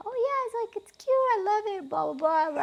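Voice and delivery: high, sing-song forced enthusiasm; pretending to love it; fake polite praise. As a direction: gush it falsely sweet. Her own polite reply quoted.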